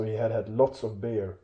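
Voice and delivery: plain reading voice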